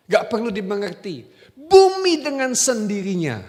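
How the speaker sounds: loud, high, slowed preaching; full-force preaching. Acted. Preacher urges the congregation to simply believe, full-force preaching. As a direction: preach it out with fire.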